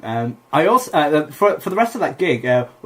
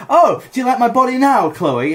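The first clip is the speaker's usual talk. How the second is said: high, loud playful boast; raised, cheeky show-off voice; mock showing off; 9 semitones higher, 5 dB louder than usual. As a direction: crow it at the heckler. Showing off shirtless to heckler.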